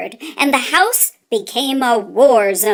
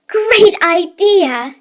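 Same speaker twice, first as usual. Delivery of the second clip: slow, higher and louder, delighted; excited; 6 semitones higher, 6 dB louder, much slower than usual. Acted. Mama cheering Papa's hole-gnawing plan, excited.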